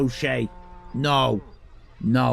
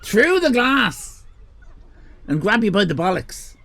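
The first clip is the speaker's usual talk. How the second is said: mock menace; 6 semitones higher than usual. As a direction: threaten them playfully, drawing it out. Mock threat to viewers.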